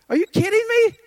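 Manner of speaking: high, loud cry of disbelief; are you kidding me, near a shriek; incredulous outburst. Real, not acted. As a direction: throw it up high in outrage. Outraged rhetorical question.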